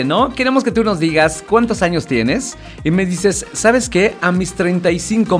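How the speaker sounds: normal talking voice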